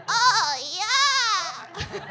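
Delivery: escalating mock moan; porn moan. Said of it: "women do sound like that in porn".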